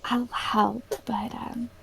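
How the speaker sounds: soft hushed whisper; gentle whispered reassurance. Acted. Whispering near sleeper.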